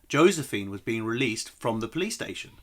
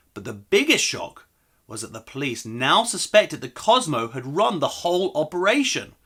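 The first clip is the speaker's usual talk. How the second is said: usual speaking voice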